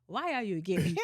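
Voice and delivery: imitated African accent breaking into laughter. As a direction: quote the clip in an African accent, then crack up. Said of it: "The bull will be like".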